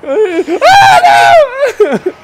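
very high, loud shriek; panicked scream. Real, not acted. Player panicking at an attack nowhere near, high scream. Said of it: "I just panicked".

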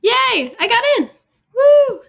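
high, loud victory yell; real joyful shout. Real, not acted. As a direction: scream with delight at good news. Reads acceptance, celebrates.

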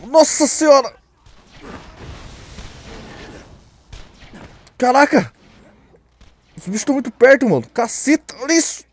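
high, loud startled yells; panicked gamer exclamations; frantic yelling. Real, not acted. Monsters close, frantic.

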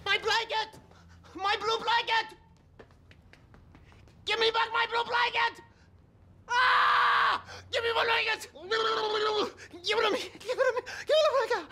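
high-pitched hysterical screaming; blanket panic. Acted. Accountant screaming for his snatched blue blanket, hysterical panic. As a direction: shriek like a child robbed of a comfort object.

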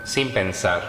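measured meditation voice with long pauses; guided meditation. Acted. Yoga teacher leading listeners through Yoga Nidra, guided meditation. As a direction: guide them phrase by phrase, leaving long silences between.

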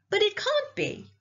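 high-pitched protest from a listener; dismayed protest. A narrator's reading. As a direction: refuse to accept the ending. Abilene protests the ending.

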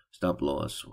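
usual speaking voice

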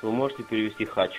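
usual speaking voice

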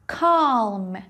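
usual speaking voice

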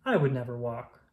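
plain reading voice

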